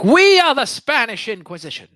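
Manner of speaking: high, loud, grand proclamation; mock inquisitor. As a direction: announce it like a comic inquisitor. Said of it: "He's like".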